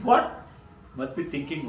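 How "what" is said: startled, higher and louder; a sudden shocked yelp of disbelief; the businessman, stung by the rishi's words, shocked. Retold or imitated. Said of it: "the gentleman is shocked".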